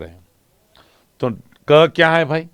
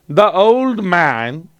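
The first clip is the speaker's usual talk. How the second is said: put-on very slow, leaning on "old"; slow stressed reading; 3 semitones higher, much slower than usual. Shown as a demonstration. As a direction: stretch "The old man" to show the m sound. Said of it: "तो म का sound". ("The old man" बहुत धीरे, म पर ज़ोर देकर)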